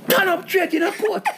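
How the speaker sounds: high-pitched judge cutting him off; imitated judge's high, slow reprimand; mock stern interruption. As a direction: drag it out high and scolding. Judge cuts off his apology.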